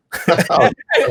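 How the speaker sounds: high, loud laugh breaking out; burst of laughter. Real, not acted. Laughing at the absurd threat while telling the story. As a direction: laugh hard at the memory.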